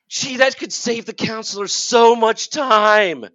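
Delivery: sarcastic. Acted. Ranting about colleges' SRAR claims.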